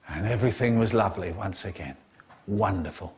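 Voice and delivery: low, quick, understated sign-off; deadpan refrain. A narrator's reading. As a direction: drop low and wrap it up drily.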